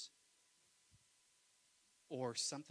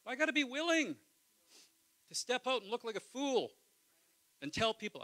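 loud, passionate preacher voice; preacher at full force; preaching; 11 semitones higher, 11 dB louder than usual. Acted. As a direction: preach it hard to the congregation.

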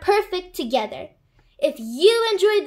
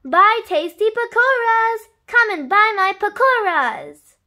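high, loud market seller's cry; street vendor shout; 7 semitones higher, 6 dB louder than usual. A narrator's reading. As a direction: hawk it across a busy bazaar. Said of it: "Priya was shouting out".

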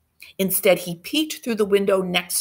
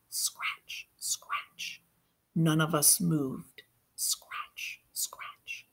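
breathy scratch sound effect; whispered scratching; 2 semitones lower, 10 dB quieter, much slower than usual. Acted. Kids hiding, the thing scratching at the door, whispered.

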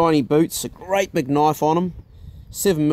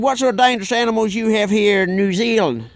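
put-on Texan accent; 6 semitones higher than usual. Imitating Chuck.

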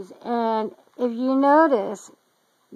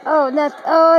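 ordinary talk, nothing marked

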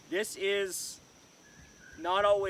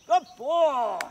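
excited praise; 9 semitones higher, 9 dB louder than usual. Real, not acted. Praising dog after retrieve.

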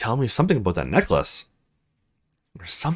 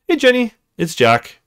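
higher, louder quick greeting; breezy recorded hello; own voicemail greeting; 10 semitones higher, 4 dB louder than usual. Acted. Jack's own voicemail greeting, higher and brisk.

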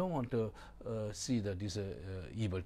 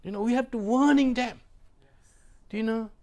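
loud, high exhorting; exhortation; 9 semitones higher, 10 dB louder than usual. Real, not acted. Pastor urging parents to warn their children, loud. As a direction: urge the flock.

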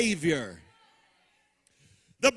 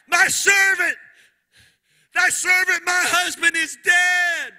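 high loud desperate wail; widow crying out; 5 semitones higher, 6 dB louder than usual. Acted. Preacher voicing the widow crying to the prophet, loud and high. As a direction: cry it out like a widow begging the prophet. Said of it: "she's crying out".

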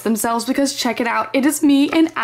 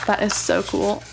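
usual speaking voice

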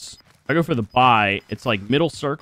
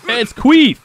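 loud, high-pitched taunting shout; yelling; 13 semitones higher, 10 dB louder, slower than usual. Real, not acted. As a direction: holler abuse at another player. Yelling at another player in game.

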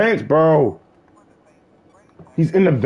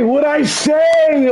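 slow, stretched, a bit higher; drawn-out question; 4 semitones higher, much slower than usual. Real, not acted. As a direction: drag the words out. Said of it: "drawn-out repeat".

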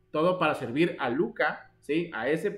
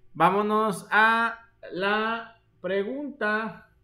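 drawn-out announcement; 4 semitones higher, much slower than usual. Real, not acted. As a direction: stretch every word out slowly. Teacher moves to the next question. (anunciando la pregunta muy despacio)

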